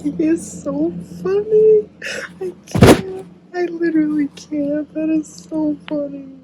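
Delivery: high, helpless squeal; overcome with amusement. Real, not acted. Reader finding the hero so funny, high helpless gushing. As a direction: pitch it way up, can barely cope.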